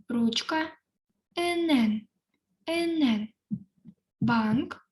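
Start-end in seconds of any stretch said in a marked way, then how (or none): 1.4-3.3 Енен higher with a falling tune; Korean pronunciation. Shown as a demonstration. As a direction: model Енен. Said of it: "я прочитаю по декілька разів слово". («Енен» вище, чітко)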